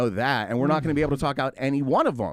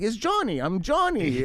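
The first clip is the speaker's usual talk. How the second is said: much higher, chirpy put-on voice; mock broken English; 8 semitones higher, slower than usual. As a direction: play the buyer eagerly typing back. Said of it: "that's when he's like".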